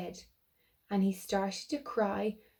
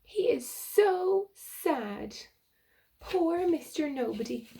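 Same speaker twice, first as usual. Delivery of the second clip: sympathetic; 7 semitones higher than usual. Real, not acted. Sympathy aside to child.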